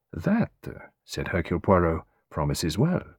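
a lightly voiced foreign detective, amused and probing; Hercule Poirot, teasing. Poirot teasing his young client, lightly voiced. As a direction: play the old detective gently testing his visitor.

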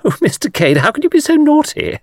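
very high, coquettish mock scolding; coquettish young woman.

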